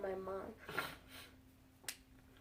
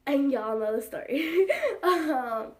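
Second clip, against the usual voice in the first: real laugh running through louder words; louder, higher laughing aside; 3 semitones higher, 8 dB louder, slower than usual. Real, not acted. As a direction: laugh it out at the memory. Laughing about ruined plans.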